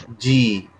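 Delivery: जी, quiet and drawn; Chinese j as ji. Shown as a demonstration. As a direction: say जी with a soft j and a long ee. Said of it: "Chinese में हम बोलेंगे जी".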